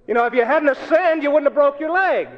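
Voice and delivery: loud, high, blaming tone; accusing. Acted. Judgmental believer to an injured person, accusing. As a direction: lay the blame on them loudly. Voicing judgmental church people.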